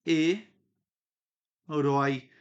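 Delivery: "e", a clear pause, then "rói"; syllable by syllable. Shown as a demonstration. Teacher showing the separated syllables, with a pause between. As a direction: split "herói" into two detached syllables. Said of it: "quando eu separei aqui".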